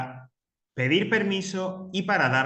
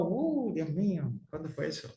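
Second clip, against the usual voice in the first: playful fake astonishment; mock surprise; 2 semitones higher, 5 dB quieter than usual. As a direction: pretend to be shocked at how long ago it was. Teacher mocks how long ago.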